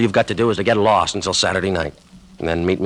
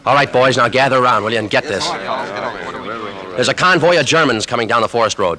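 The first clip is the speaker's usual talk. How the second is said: raised, louder voice to the group; calling out; 5 semitones higher, 4 dB louder than usual. Acted. Lieutenant rallying his men in the camp, calling out. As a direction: call the boys in to gather round.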